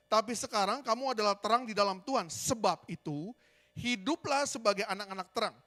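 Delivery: baca ayat dengan lantang dan mantap; pembacaan Alkitab. Acted. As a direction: bacakan firman dengan keras kepada jemaat. Membaca ayat Efesus. (pembacaan ayat yang lantang dan khidmat)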